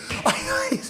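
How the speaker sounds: high exasperated laugh; incredulous laugh. Real, not acted. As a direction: laugh in disbelief. Laughs at the absurdity.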